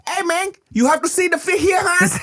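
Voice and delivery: very high, yapping Vietnamese uncle impression; crazy Vietnamese uncle. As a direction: yap excitedly in a thick Vietnamese accent. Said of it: "he reminds me of all those, like, crazy uncles".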